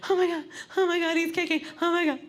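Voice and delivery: frantic excitement. Retold or imitated. Pregnant friend feeling the baby kick, frantic squeal. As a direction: squeal it in a rush. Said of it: "She would go crazy".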